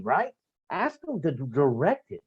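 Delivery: usual speaking voice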